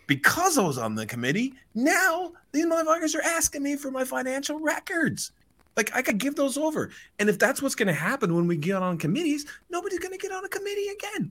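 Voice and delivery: high, hammed-up indignant whine; put-on squeal of complaint; mock whine. Owner griping about handing over financial records, mock whine. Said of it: "then he basically whines".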